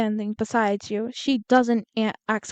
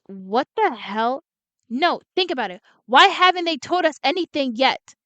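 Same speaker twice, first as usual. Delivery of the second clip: ordinary talk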